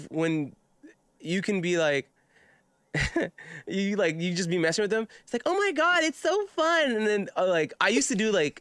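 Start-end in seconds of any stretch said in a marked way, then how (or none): 5.5-6.9 put-on high-pitched ditzy girl voice; ditzy blonde. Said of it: "sounds like a ditzy blonde".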